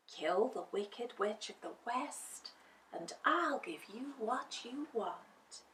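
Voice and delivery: soft whispered murmur. A narrator's reading. Winged lady murmurs the command. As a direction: murmur it softly. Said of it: "she murmured".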